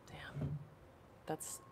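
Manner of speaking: whispered. Real, not acted. Sympathy for Leslie's skin.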